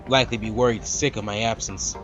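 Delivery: normal talking voice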